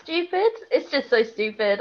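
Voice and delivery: ordinary talk, nothing marked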